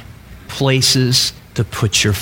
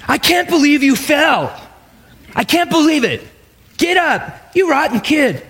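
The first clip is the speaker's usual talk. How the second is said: mock-exasperated parent, pitched high; hammed-up scolding of a clumsy toddler; 13 semitones higher than usual. Parent scolding the toddler who fell, mock exasperated. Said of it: "the parents going".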